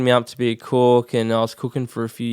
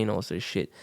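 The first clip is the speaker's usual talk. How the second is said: normal talking voice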